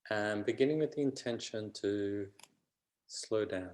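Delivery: unhurried meditation voice with long pauses; calm, even delivery, phrase by phrase; guided meditation. Real, not acted. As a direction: gently guide them inward, leaving space between phrases.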